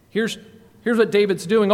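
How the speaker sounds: ordinary delivery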